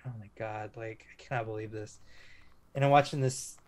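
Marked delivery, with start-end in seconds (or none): 0.1-2.0 quietly stunned, under the breath; a mild retold gasp of disbelief, kept soft. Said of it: "I'm just like".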